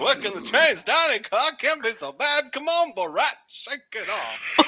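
put-on high-pitched old-time actor pep talk; thirties actor. Borat psyching himself up for dining car, thirties actor voice. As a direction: talk yourself up like a thirties movie rookie. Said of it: "I'm like a thirties actor".

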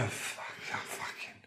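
whispered curse trailing off. Acted. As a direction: hiss the last curse. Husband cursing.

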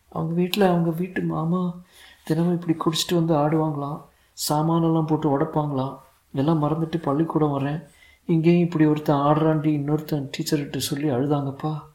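put-on high-pitched little boy voice; sad small boy. A narrator's reading. Son explaining his teacher's trouble, sad small boy voice. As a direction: pitch it up as a child confiding.

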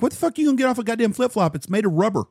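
high, fast angry rant at the search; mock-outraged rant over a flip-flop; exasperated outburst. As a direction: fire off the complaint in disbelief. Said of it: "I'm like".

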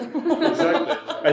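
a slow, squeaky laughing exactly. Real, not acted. Guest agreeing with the host's joke, high laughing. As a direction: stretch it out while cracking up.

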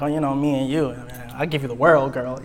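low, soft mock-romantic patter; playful fake crooning to a girl; silly sweet-talk. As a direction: lay on a hammed-up smooth come-on. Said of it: "I'm being silly".

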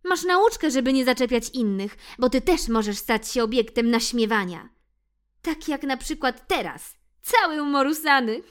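high, loud teasing mouse voice; gloating mouse. Acted. Ixi teases the muddy cat, high loud. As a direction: rub the lesson in playfully. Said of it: "powiedziała myszka".

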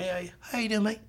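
lightly imitated British bloke's greeting; British accent. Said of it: "He was a British dude".

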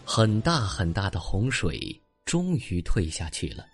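usual speaking voice

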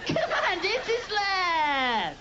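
high, pushy coaxing of love personified; love pleading. Pretend. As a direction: wheedle like love at the door. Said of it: "Love comes to my life and goes".

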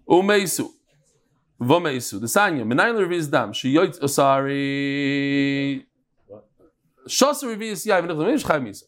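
Talmud study singsong; yiddish accent. Shown as a demonstration. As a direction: chant the Aramaic like a yeshiva student. Chanting Mishnah text in Yiddish-inflected lilt.

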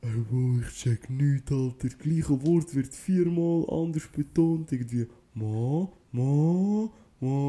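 exasperated friend after class; mock frustrated student. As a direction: whine it like a lost learner. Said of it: "der kommt so zurück". (Freund nach der ersten Lektion, genervt)

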